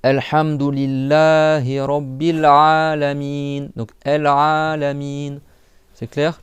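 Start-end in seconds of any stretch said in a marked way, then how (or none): 0.0-3.7 ALHAMDULILLAHI RABBIL ALAMIN slow, long-held vowels; prolonged recitation. Shown as a demonstration. Teacher shows pupils where to prolong, slow recitation. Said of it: "Tu prolonges là et tu prolonges là". 4.0-5.4 EL ALAMIN slow, with long "aa" and "miin"; prolonged vowels. Shown as a demonstration. Teacher repeats the word to show prolongation, held vowels. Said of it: "tu prolonges là".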